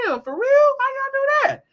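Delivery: very high, squeaky disbelief; incredulous protest. Retold or imitated. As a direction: protest in a shocked falsetto-high voice. Retold shocked reaction to police.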